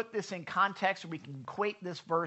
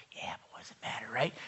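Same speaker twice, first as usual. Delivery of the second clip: sly whisper; 7 semitones lower, 6 dB quieter, much faster than usual. Retold or imitated. Coworker's excuse.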